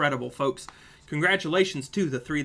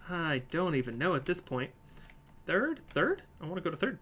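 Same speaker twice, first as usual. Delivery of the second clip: live play-by-play; 4 semitones higher, 4 dB louder than usual. Real, not acted. Streamer calling a marble race for viewers, lively play-by-play. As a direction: race commentary, rising with the action.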